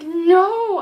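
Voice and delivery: loud, high, dismayed cry of no; hammed-up wail of protest; retold outcry. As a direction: cry out in mock horror. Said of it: "I literally said No out loud".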